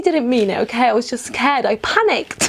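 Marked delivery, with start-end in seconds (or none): none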